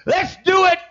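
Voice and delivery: high, loud, all-in call; decisive cry. Acted. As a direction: fling the challenge out high and loud. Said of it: "when you gotta say".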